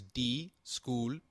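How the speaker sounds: di-school-pe-me, each syllable slow and spelt out. Shown as a demonstration. Teacher spelling out a word for learners, slow syllables. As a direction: break discúlpeme into di-school-pe-me, slowly. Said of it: "is pronounced as".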